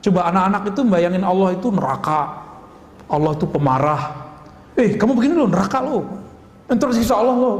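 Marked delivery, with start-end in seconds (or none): 4.8-7.6 lightly acted scolding warning, pitched up; mock threat in a higher voice; imitating scary teacher. Teacher warning children of hellfire, mock scolding. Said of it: "seserem guru-guru sekarang".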